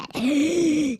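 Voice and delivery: long, high-pitched gasp. Pretend. Streamer breaking off tech talk, long high gasp. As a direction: suck air in on a high squeal. Streamer gasps between remarks.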